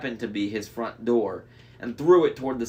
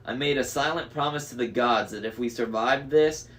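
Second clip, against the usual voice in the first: plain reading voice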